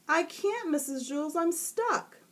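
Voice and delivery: put-on high, overdramatic boy voice; whiny stuck boy. A narrator's reading. Stuck boy complaining to teacher, high whine. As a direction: wail it like a doomed kid. Jason's voice.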